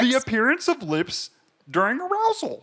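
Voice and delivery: very high Kermit-like squeal; Jordan Peterson. Acted. Co-host parodying lipstick theory, squeakily high impression. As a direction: push the puppet voice absurdly high. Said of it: "Screaming at a decibel that only dogs can hear".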